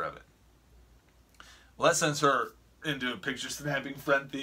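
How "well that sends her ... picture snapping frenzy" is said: normal talking voice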